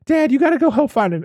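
high, quick urging; a hint of an urgent daughter; lightly voiced pleading daughter. Kim urging Dad to find him, lightly pleading.

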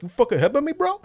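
high loud whiny disbelief; stunned bro. Retold or imitated. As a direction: squeal it like a dazed bro. Dazed bro after headbutt.

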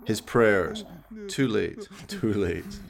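usual speaking voice

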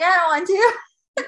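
whiny reluctance. Retold or imitated. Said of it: "I was like".

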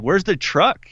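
mildly incredulous, voice lifted; puzzled question. Retold or imitated. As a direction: ask it baffled, without shouting. First, milder take of question.

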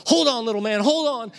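loud, high urgent encouragement; dad yelling to son on coaster; urgent calling. Retold or imitated. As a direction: shout it over the ride. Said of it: "the whole time I'm going".

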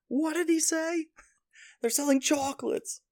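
very high, slow, drawn-out cartoon voice; exaggerated falsetto impression; cartoon character impression. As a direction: squeak it out like a doddering cartoon character. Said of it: "does a great impression of that".

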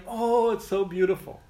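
high, mock-gushing admiration; gushing praise. Parent praising a small child's drawing, gushing and high-pitched. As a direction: coo over it in a high parent's voice.

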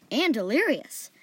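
high, loud, slow TV pitch; punchy, stretched announcer delivery; infomercial announcer. A narrator's reading. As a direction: sell it like an infomercial price. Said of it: "like he was announcing the price of something".

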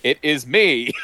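un-accented failed attempt at a catchphrase; Chris Pratt. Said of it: "it cuts to Chris Pratt going".

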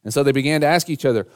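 ordinary talk

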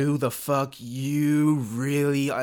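slow, drawn-out threat; mock menacing slow read; quoted movie line. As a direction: drag it out like a gangster. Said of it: "somebody says".

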